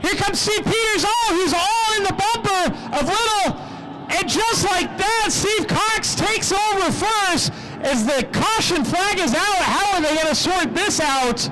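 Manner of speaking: high-energy track announcer, pitch climbing as the lead changes; live race play-by-play. Real, not acted. Track announcer calling laps and passes for the crowd, excited play-by-play. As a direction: call the race with full announcer projection.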